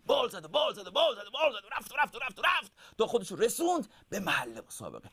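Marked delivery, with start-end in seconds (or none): 0.1-2.7 high, driving repeated beats; rhythmic storytelling. A narrator's reading. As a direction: chant the repeats like beating wings. Narrating the long flight.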